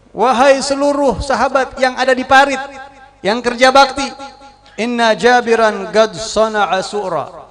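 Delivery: high, loud call to the crowd. Acted. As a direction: call everyone across the trench. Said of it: "Nabi malah teriak". (teriakan keras memanggil sahabat)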